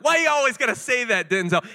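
mock exasperation. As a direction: playfully scold the actor. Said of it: "I was like".

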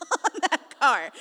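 loud, high burst through laughter; laughing. Real, not acted. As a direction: break up laughing on the words. Cracks up at own exaggeration.

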